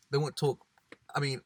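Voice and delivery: ordinary talk, nothing marked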